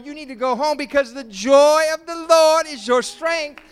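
loud, high, hammering each don't; full-force preaching. Shown as a demonstration. Pastor exhorts the congregation not to be sad, full-force preaching. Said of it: "for the joy of the Lord is your".

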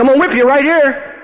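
high, put-on fighting talk; imitated angry threat. Bert's buddy threatening the preacher, imitated anger.

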